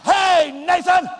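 sharp shouted call of a name; loud shout. Shown as a demonstration. Preacher shouting at a chatty boy, loud. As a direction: bark the boy's name across the room. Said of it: "I'm not gonna say".

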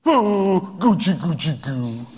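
wife cooing at her husband; mock baby talk. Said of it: "how this gal is talking".

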